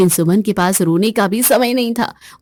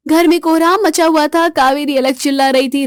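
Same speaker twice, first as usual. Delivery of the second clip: normal talking voice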